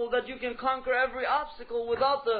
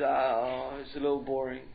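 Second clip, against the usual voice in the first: very low, bored and reluctant; droopy, deep-pitched apathy; sluggish youth; 10 semitones lower than usual. Acted. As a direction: play a listless youth begging off. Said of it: "They're always sluggish".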